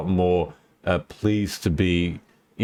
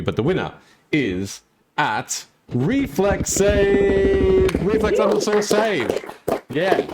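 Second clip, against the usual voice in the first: high, bright winner reveal; hyped giveaway announcement; 7 semitones higher, slower than usual. Real, not acted.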